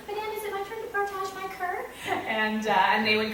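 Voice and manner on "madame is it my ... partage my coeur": put-on very high, pleading little-kid voice; high, impatient child question; eager child. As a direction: imitate a child who cannot wait for a turn. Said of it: "they couldn't wait till".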